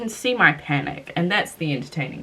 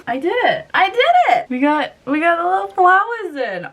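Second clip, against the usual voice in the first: much higher, louder joyful cheer; triumphant squeal; 10 semitones higher, 4 dB louder than usual. Real, not acted. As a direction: cheer in real relief at having done it. Earrings finally in.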